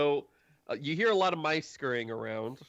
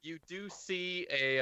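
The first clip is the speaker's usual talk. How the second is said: ordinary delivery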